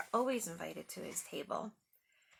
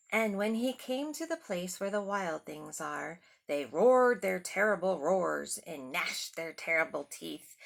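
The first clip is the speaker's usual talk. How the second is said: louder, building storybook narration; dramatic storybook reading; 2 semitones lower, 6 dB louder than usual. A narrator's reading. Reading the monster arrival to the kids, dramatically. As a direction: make the monsters sound big. Reads picture book aloud.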